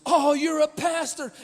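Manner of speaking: high, loud startled exclamation; mock surprise. Lady on phone realizing she cussed before a pastor. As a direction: act out her shocked realization. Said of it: "she goes".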